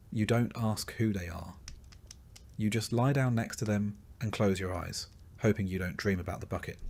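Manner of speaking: ordinary delivery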